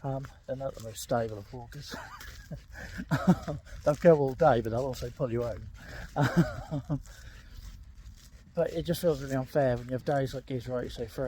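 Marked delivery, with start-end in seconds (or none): 2.0-3.8 a short airy laugh at the dog; quick breathy chuckle while being pulled. Real, not acted. Laughs at pulling spaniel. 7.0-8.5 a soft, almost silent laugh; hushed laugh at the dog; quiet laugh. Real, not acted. Laughs at dog pulling home.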